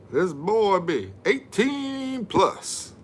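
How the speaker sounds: high, slow put-on voice; put-on silly voice. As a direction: drawl it out like a joke character. Jokey answer in put-on voice.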